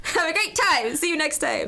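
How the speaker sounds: high, cheery server farewell; cheery send-off. Retold or imitated. Server saying goodbye to her diners, cheery and high.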